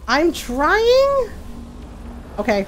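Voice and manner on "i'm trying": stressed. Real, not acted. Answering "Move.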